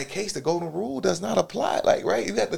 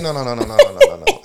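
laughing refusal; 8 dB louder than usual. Retold or imitated. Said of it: "I said".

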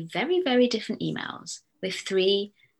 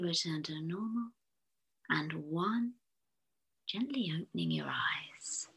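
guided meditation. Acted. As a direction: lull the listener gently into calm. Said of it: "using my posh hypnotherapist's voice".

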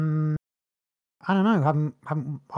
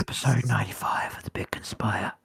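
breathless whisper; 4 semitones lower than usual. Real, not acted. Breathless show intro whispered.

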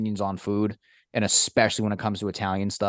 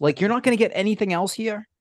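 pitched-up, disbelieving question; incredulous mock question; 12 semitones higher than usual. Mocking a customer's small deli order, high and incredulous. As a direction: ask it as if the order is absurd.

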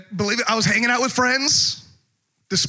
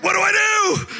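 high-pitched panicky plea; acted freak-out, voice shooting up; panicked; 12 semitones higher than usual. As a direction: plead in a hammed-up panic. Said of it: "I freaked out enough".

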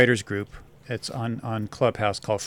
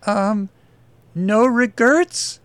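high, hesitant questioning voice; timid reading; 9 semitones higher, 5 dB louder, much slower than usual. Acted. Desk girl reads the tattoo to her attacker, timidly. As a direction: read it timidly, slowly rising into a question. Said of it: "Lily timidly read".

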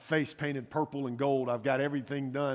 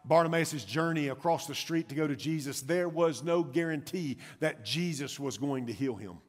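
normal talking voice

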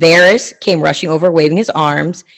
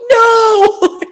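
high squealing no with laugh; laughing shriek of protest; shocked laughing no; 5 dB louder than usual. Retold or imitated. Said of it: "I'm like".

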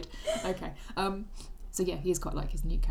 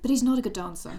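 loud, higher teasing imitation; mock friend's voice; 4 semitones higher, 7 dB louder than usual. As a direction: finish the teasing impression loudly. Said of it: "I thought you were gonna say".

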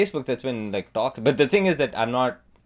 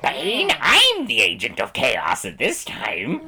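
mock Joker voice; hammy cackling villain; 3 semitones higher, slower than usual. Continues Joker bit.

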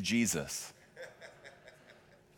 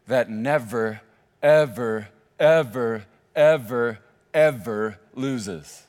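each ever punched louder, the last ever loses very slow; stressed ever, ever, ever; 7 dB louder, much slower than usual. Shown as a demonstration. Preacher telling the church their team never loses, punching each ever. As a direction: hammer every ever, then stretch ever loses. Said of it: "repeated ever, drawn out".

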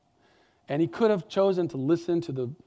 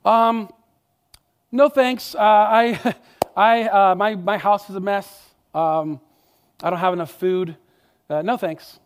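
a nervous man making excuses; flustered excuses; 2 semitones higher, 6 dB louder than usual. Acted. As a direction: hem and haw to get out of it. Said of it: "the excuses would flood".

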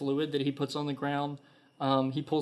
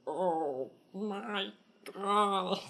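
higher, soft groggy groan; dazed kobold; 6 semitones higher, 3 dB quieter, much slower than usual. Acted. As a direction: wake up holding a sore head. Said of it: "he goes".

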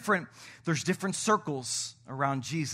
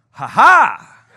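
triumphant laugh; 7 semitones higher, 19 dB louder than usual. Real, not acted. Pastor catching out a congregant.

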